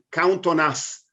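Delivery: ordinary talk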